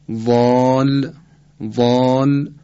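وال as emphatic Arabic "ḍaal", vowel held very long; sustained backed "ḍaal" for وال; heavy long alef. Shown as a demonstration. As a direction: stretch وال slowly with a dark, rounded long "aa". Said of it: "الف رو در این حروف استعلا".

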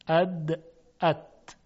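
اد buzzing, then ات crisp and voiceless; voiced d versus voiceless t. Shown as a demonstration. Teacher repeating اد and ات for students. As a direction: contrast اد and ات, voicing on then off.

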